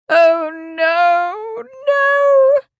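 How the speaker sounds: mother wailing at the news; moaned. Acted. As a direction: moan as if mortally wounded. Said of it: "Lady Marsden moaned".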